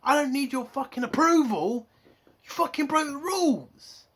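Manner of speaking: loud, high-pitched indignant rebuke; heated captain's outburst, acted; acted angry retort. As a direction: throw the apology back, shouting. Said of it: "Saru should have shown and said".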